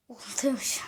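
a whispered aside. Real, not acted. Muttering to himself that he forgets, whispered. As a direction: drop to a breathy whisper.

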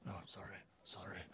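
whispered, sheepish apology; whispered sorry. Acted. Trovis apologizes, hushed.

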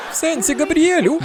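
very high, squeaky kid impression; alarmed child voice. Frankie questions dad about the police, alarmed child voice.

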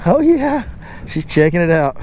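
normal talking voice

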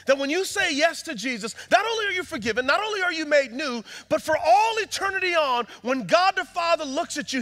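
high, loud preaching voice building up; preaching at full force. Acted.

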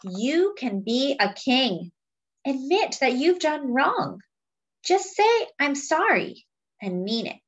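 slow, weighty refrain then lively lines; storybook reading with a slow refrain; reading to children. Acted. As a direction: read the book aloud to the class. Reading picture book to children.

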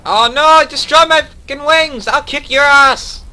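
high raging scream; furious yell. Real, not acted. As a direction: rage at the enemy. Wings destroyed, raging.

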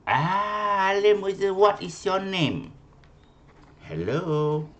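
high, sustained teacher's call. Real, not acted. Calling a silent pupil online.

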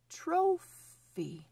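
normal talking voice

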